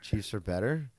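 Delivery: "Chiefs are better" softly, lifting at the end; quiet questioning rise on "better". Shown as a demonstration. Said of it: "I said it with a higher inflection".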